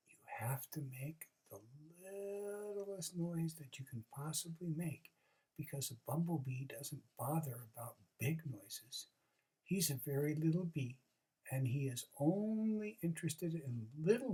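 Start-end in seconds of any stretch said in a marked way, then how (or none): 0.1-9.1 soft half-whispered secret. Acted. Groundhog whispering a secret to the rabbit, softly. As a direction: hush it like a secret. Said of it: "I'll whisper to you".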